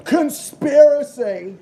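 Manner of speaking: high, loud, slow drawn-out shout; mock conspiracy cry. Streamer joking about purple Powerade, hammed-up shout. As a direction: bellow it like a hammy conspiracy theorist.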